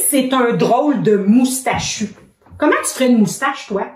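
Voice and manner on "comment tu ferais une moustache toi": normal talking voice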